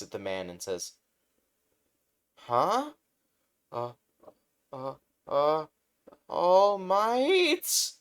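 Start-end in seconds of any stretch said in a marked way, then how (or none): 2.3-7.9 much higher, louder stammering fanboy; starstruck gasp. Acted. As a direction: stutter in disbelief at your idol. Starstruck fan meets hero.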